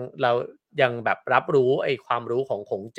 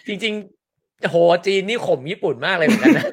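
higher, drawn-out laughing wow; amused amazement; 6 semitones higher, slower than usual. Real, not acted. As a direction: marvel at it through laughter. (ทึ่งปนขำ เสียงสูงขึ้น)

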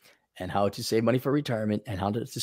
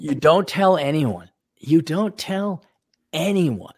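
"don't tell anyone" slowed right down and pitched up; emphatic insistence; 5 semitones higher, slower than usual. Real, not acted. Host warning about lottery winnings, emphatic insistence. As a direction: spell out "You don't tell anyone" slowly, higher, word by word.

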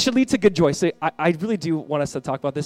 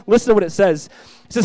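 ordinary delivery

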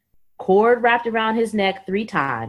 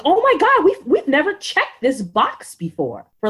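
gasping nurses' disbelief, starting high; imitated amazement; 8 semitones higher than usual. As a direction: exclaim it like astonished nurses. Nurses' amazed reaction acted out.

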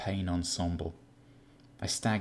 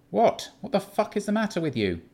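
much higher, louder exasperated snap; irritated outburst; 12 semitones higher, 6 dB louder than usual. Acted. Keith snaps at his shaken flatmate, exasperated. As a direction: blow up at him.

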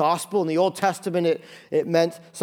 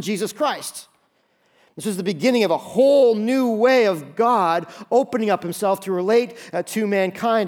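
fervent preaching; 4 semitones higher than usual. Acted. Pastor pressing the congregation on the second beginning, fervent preaching. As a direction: drive the point home to the pews.

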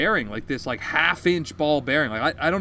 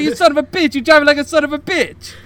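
high, loud, hammed-up Italian yelling; mock Italian accent; 11 semitones higher, 10 dB louder than usual. Angry driver cursing him out, mock Italian accent. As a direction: shout it as an outraged Italian caricature.

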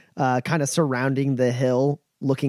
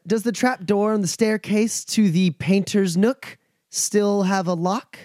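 noblewoman's order; 7 semitones higher than usual. Acted. GM voices Eliza.

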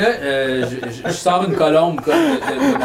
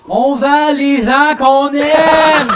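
slow, loud, high drawn-out yell; shouted; 10 semitones higher, 7 dB louder, much slower than usual. Pretend. Player shouting it to the tavern. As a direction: belt it out like a confession. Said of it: "Je peux-tu crier".

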